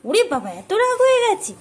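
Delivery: high, loud teasing "oh my"; playful mock alarm to a pet; high mock dismay. Real, not acted. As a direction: tease the sulky bird in a high, loud voice. Teasing the angry bird.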